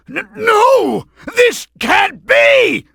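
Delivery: high, slow, stammering cry of defeat; panicked disbelief. Acted. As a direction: wail that this cannot be happening.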